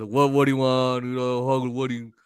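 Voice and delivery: mocking a surly drive-thru worker; rude attitude. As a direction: imitate him in a dismissive, mumbled monotone. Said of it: "This guy had the same attitude".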